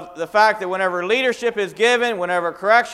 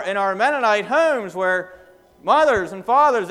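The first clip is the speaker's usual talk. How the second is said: ordinary delivery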